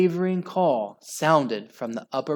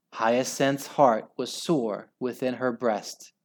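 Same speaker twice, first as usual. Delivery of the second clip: ordinary delivery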